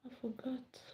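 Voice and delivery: whispered to herself; hushed private admission. Real, not acted. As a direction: breathe it out in a hush. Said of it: "muttering to herself".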